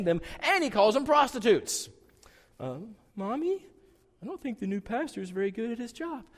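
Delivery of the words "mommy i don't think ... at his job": put-on high, small child telling on the pastor; a kid complaining to mom about the pastor; child's voice.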